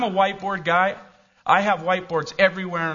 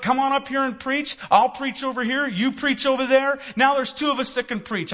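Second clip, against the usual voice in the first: full-force preaching; 9 semitones higher than usual. Acted. Pastor rallying his church to share the pulpit, fervent. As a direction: drive it home like a revival preacher.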